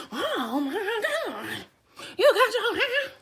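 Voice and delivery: dramatic coworker. Retold or imitated. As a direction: ham up a gushing coworker. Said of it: "Like, the dramatic".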